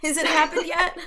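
high timid nervous question; nervous whisper. Retold or imitated. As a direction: ask it nervously, high and scared. Scared in movie theater.

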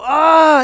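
high, loud pained gasp of dismay; anguished cry. Pretend. Churchgoer hearing of the slave trade, pained loud cry. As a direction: cry out as if struck by heartbreak. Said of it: "and you just go".

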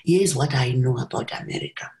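ordinary delivery

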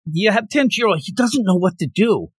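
ordinary talk, nothing marked

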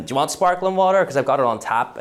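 high, loud host voice, laughing; laughing hammed-up host voice; impression of the host. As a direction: offer water like the host. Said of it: "from back of the throat".

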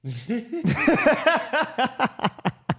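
big high-pitched cackle; loud high laugh. Real, not acted. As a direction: burst out laughing. Laughing after weird trailer.

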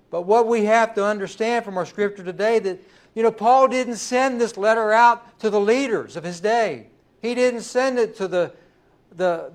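loud, rising preacher's build; preaching at full force. Acted. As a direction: preach it out to the congregation.

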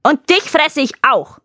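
loud, high threatening roar; monster shout. Acted. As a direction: roar it at the herdsman. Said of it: "Bumberlik schrie".

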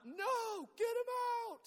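high-pitched frantic yell; panicked yell. Retold or imitated. Spectator demanding the matador be pulled out, panicked yell. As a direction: cry out in panic at the ring. Re-enacting his panicked shouting in the stands.

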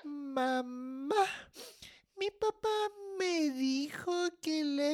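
soft, drawn-out sobbing that swallows the words; tearful blubbering. Acted. Child tells mom on dad, tearful and unintelligible. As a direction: try to explain, then break down crying. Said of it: "no te entiendo".

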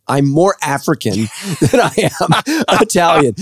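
laughing through the line. Real, not acted. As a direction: giggle out the punchline. Laughing at own DNA result.

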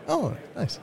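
put-on high, soft girlish voice; Anna's voice. Speaker voicing Anna, high and soft. Acted meet-cute dialogue, Anna voice.

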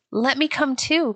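begging. A narrator's reading. Little Mary asks to join the sand play, begging. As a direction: beg lightly to be included. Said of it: "begged Mary".

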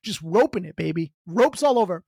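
usual speaking voice

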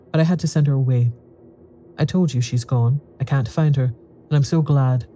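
plain reading voice

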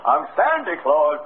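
ordinary talk

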